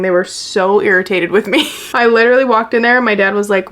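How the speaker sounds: ordinary delivery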